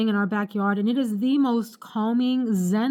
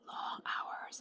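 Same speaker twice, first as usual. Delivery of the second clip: whispering; 18 dB quieter than usual. Real, not acted. Exhausted, trailing into whisper.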